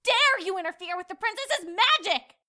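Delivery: high, loud outraged scolding. Acted. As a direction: tell him off furiously. Angry scolding of narrator.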